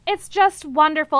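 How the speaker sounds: usual speaking voice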